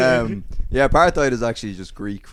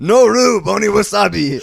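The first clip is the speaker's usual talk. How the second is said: higher and louder, laughing through it; loud punchline voice; 10 semitones higher, 12 dB louder than usual. Retold or imitated. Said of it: "And he goes".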